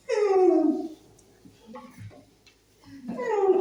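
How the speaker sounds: high hummed phone buzz; imitated vibrate hum.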